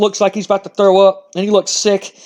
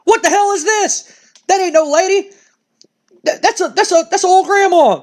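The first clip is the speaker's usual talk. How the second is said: horrified shock; 11 semitones higher than usual. Retold or imitated. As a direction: recoil in shocked disbelief, pitched way up. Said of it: "and he says".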